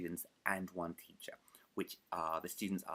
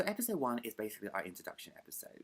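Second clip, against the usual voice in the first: usual speaking voice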